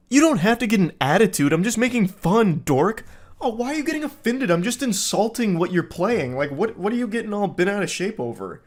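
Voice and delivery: high, quick whiny protest; mock defensive guy. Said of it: "he's so aggressive with everything".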